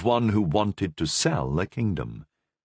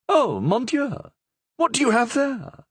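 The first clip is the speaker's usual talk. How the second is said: high queenly voice. Acted. Queen to her lady-in-waiting, curious high voice. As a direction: let the Queen marvel at the covered cage.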